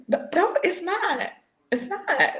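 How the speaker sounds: very high-pitched flustered protest; mock indignant refusal. Still refusing the friend's okay, mock indignant refusal. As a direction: cut off the apology in a comic high pitch.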